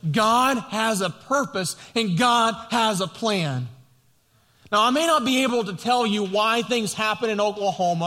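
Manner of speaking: full-force pulpit preaching; impassioned preaching. Acted. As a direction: preach it out to the congregation.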